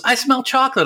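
high, eager sister voice; impersonating sister. Retold or imitated. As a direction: squeal it like the excited sister. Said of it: "your impersonation of her".

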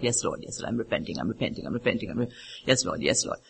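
low, hushed run of repentance; repentant prayer. Retold or imitated. Believer repenting to the Lord as past sins come up, hushed prayer. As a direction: pray it softly under your voice, over and over.

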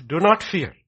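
high, loud preacher's command; forceful proclamation. Real, not acted. Preacher proclaims it, then repeats softer.

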